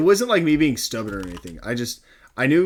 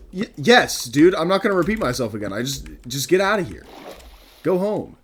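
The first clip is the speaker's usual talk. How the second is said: louder, quicker, fed-up retold reply; exasperated retort; 5 semitones higher, 4 dB louder than usual. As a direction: snap back that you won't repeat yourself. Retold telling customer to leave.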